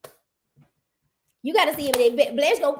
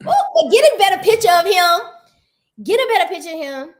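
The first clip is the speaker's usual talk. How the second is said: higher, loud insistence; loud demand; 5 semitones higher, 9 dB louder than usual. Real, not acted. Host loudly demanding a better picture of her crush. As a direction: insist loudly they fix it.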